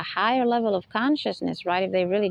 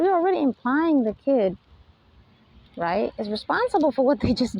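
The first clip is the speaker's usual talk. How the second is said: ordinary talk, nothing marked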